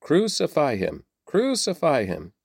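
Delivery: high, restrained cry of a crowd; narrated crowd shouting. A narrator's reading. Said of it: "began shouting".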